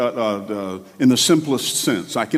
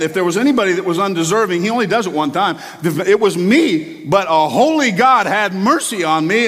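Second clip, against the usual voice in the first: usual speaking voice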